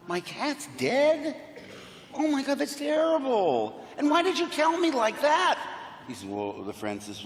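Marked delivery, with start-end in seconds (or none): 0.1-5.6 higher and higher, aghast; shocked disbelief. Retold or imitated. Pet owner hearing blunt news, rising shocked outrage. Guy hears his cat died.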